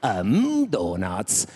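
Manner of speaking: swooping Italian-dub cartoon voice, sinking low; Homer dubbed in Italian. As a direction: melodramatic Italian voice actor as a cartoon dad. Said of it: "had Homer in Italy".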